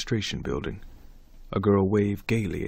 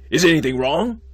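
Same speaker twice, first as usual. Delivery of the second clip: alarmed; 7 semitones higher, 9 dB louder than usual. Acted. Alarmed dean questions student.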